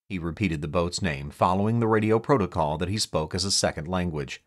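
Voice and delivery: ordinary delivery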